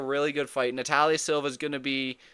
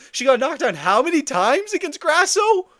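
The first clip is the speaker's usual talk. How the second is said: incredulous yell; 12 semitones higher, 9 dB louder than usual. Real, not acted. Heated rant about knockdowns.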